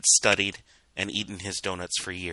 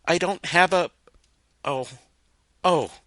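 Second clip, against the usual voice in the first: higher, louder shocked gasps; startled; 10 semitones higher, 6 dB louder than usual. A narrator's reading. Filly shocked by his brother's death, stammering. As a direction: caught off guard, lost for words.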